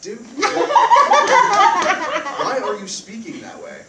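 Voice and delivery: slow, loud laughing question; laughing disbelief. Real, not acted. [name] laughs in disbelief at Dan's assassin voice, slow and loud. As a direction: crack up while asking.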